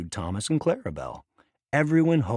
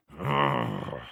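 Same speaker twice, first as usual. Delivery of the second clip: much lower, menacing growl; 11 semitones lower than usual. A narrator's reading. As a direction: growl deep in the throat. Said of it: "Diesel growled".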